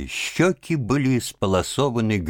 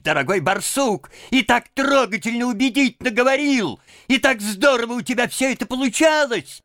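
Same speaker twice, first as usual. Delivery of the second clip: very high, excitable, self-justifying voice; jabbering Toad; 13 semitones higher, 3 dB louder than usual. A narrator's reading. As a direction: argue eagerly at the top of your range. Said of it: "затараторил Жабби". (тараторить высоким, задиристым голосом)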